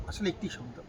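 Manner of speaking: normal talking voice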